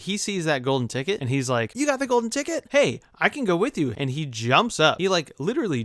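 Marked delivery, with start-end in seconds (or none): 1.7-3.9 high-pitched, eager grandpa exclamation; excited. Acted. Grandpa to grandson seeing the golden ticket, excited. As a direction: burst out with mock excitement.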